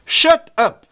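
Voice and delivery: "Shut up" high, each vowel uh. Shown as a demonstration. Teacher demonstrating U said E, high clear uh. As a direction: say "Shut up" in separated words with uh.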